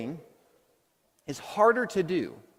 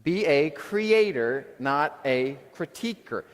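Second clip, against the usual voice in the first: slow, deliberate motto; slow emphatic repetition; slow repeat; much slower than usual. Shown as a demonstration. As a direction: lead the room through it slowly. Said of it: "Let's repeat this one".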